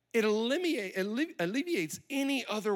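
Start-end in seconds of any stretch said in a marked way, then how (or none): none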